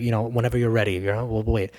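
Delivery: gentle, soft reassurance; patient boyfriend softly telling Vera to wait, gentle; very nice. Retold or imitated. Boyfriend reassuring Vera she can take her time, soft. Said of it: "he's, like, very nice".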